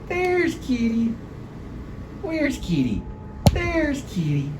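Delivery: put-on high sing-song baby talk to a cat; high pet talk. Talking to his cat Kitty under the furniture, high sing-song pet voice. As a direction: coo and cheer at the kitten like it's a baby.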